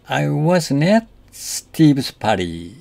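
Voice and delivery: at lifted and stressed, slow and careful. Shown as a demonstration. Teacher models the sentence stressing at. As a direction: lean on at in I wasn't at Steve's party. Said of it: "Notice that the emphasis here is on at".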